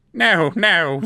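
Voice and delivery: a frightened little elf laughing it off; nervous protest. A narrator's reading. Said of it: "laughed nervously".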